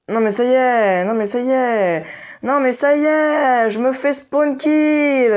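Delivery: high, loud, drawn-out frustrated wail; raging gamer. Real, not acted. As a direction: whine it out, fed up and furious. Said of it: "j'ai envie de péter un câble".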